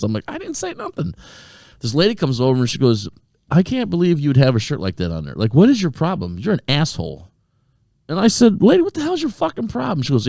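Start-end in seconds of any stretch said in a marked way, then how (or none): 0.3-1.1 very high-pitched mock protest; protesting innocence. Telling a laughing friend he did nothing, high protest. 3.5-7.3 imitated higher-pitched scolding; scolding woman. Lady confronting him over his shirt, indignant scolding. 8.6-10.0 high-pitched retold outrage; exasperated retort. Answering a lady who insulted him, exasperated high retort.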